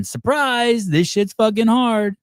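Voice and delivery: high, drawn-out gotcha announcement; spring the surprise slowly, voice way up; mock surprise reveal. Acting out the trick's sudden reveal.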